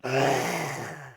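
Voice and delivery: long, loud-ish monster growl; generic monster growl. Shown as a demonstration. Streamer attempting a T-Rex impression, poor generic monster growl. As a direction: growl like a cheap monster until breath runs out. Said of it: "gonna do a generic monster growl".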